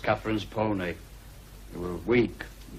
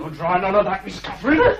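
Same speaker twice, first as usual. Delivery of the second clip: higher, louder warning; forceful shout; 10 semitones higher, 8 dB louder than usual. Acted. Hareton stopping Cathy escaping, loud warning. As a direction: stop her with a shout.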